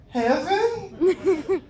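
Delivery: high, laughing heaven; laughing daze. Retold or imitated. Hospital patient answers his mom, high and laughing.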